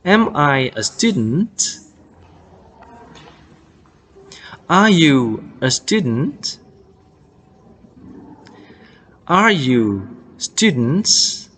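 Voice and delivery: "Am I a student?", "Are you students?" slowly, each lifting at the end; rising question intonation. Shown as a demonstration. Teacher drills learners on English questions, slow rising intonation. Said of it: "Di akhir dia naik ya".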